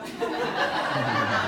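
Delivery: loud laugh breaking out; real laugh. Real, not acted. Storyteller laughing at herself before the audience. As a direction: laugh at her own mirror joke.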